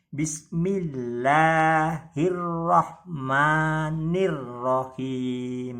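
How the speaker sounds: Bismillahirrahmanirrahim slow, doubles held and pressed; held doubled letters. Shown as a demonstration. Teacher reciting for students, doubles held. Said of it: "ditahan sejenak dan agak ditekan".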